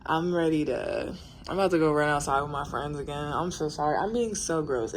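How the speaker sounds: normal talking voice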